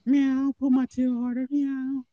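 put-on high, loud purring cat-girl plea with meows; mock cat girl. Imitating the cat girl asking to have her tail pulled, mock kitten voice. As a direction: ham up a kinky cat girl begging. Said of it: "'Cause it was like".

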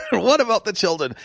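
high, hammed-up worry; mock alarmed plea. Repeats the mocked stock plea.

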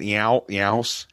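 "yow, yows" with a fronted "ee-ow" glide; Philly "ow" sound. Shown as a demonstration. Guest shows that Philly vowel, exaggerated yows. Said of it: "Some of that yow".

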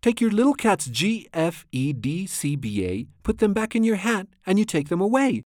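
high-pitched, scolding protest read aloud; animated, mildly yelled complaint; storybook yell. A narrator's reading. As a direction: order the cat about in a lightly acted yell. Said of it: "we yelled at the cat".

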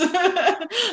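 a burst of high laughter; amused laugh. Real, not acted. Laughing at her own joke about lunatics, bright burst.

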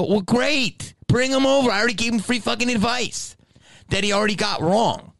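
very high, exasperated rant; sarcastic outburst. Retold or imitated. Telling the mother to bring him over, sarcastic. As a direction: retell a worked-up retort.

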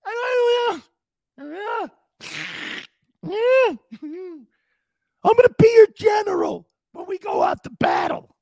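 blubbering pep talk yelled through mock tears; Marty Schottenheimer in tears. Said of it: "Here's Marty in tears".

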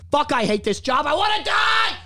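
high shout climbing to a despairing wail; exasperated outburst. Acted. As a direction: vent wildly while the mute is on. Venting while customer is muted.

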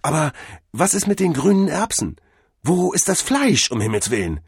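high-pitched, bewildered protest; alarmed questioning. A narrator's reading. Cordell asks where the meat is, bewildered. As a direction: question it as doubts break in. Cordell suddenly doubts the theory.

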